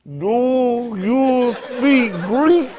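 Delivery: very slow, stretched-out "Do you speak Greek?"; drawn-out mock question. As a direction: drag every word out like a clueless tourist. Friend asking at Greek restaurant.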